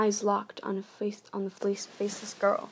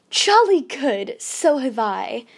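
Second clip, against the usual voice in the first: forced cheerful call; 4 semitones higher, 9 dB louder, slower than usual. A narrator's reading. Forced cheer calling back.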